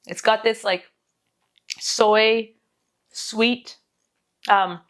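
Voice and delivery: ordinary talk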